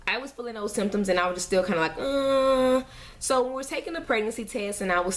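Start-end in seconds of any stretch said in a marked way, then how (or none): 2.0-2.8 long, higher, louder "uhhh"; doubtful groan. Retold or imitated. Vlogger recalling doubts about her symptoms, doubtful groan. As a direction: imitate an unsure, drawn-out groan.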